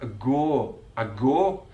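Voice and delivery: self-mocking Mackem ago with a flat single o; a go said Sunderland style, o held as one vowel; Mackem impression. Teacher imitating a Sunderland footballer's flat Mackem o. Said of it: "my impression is terrible".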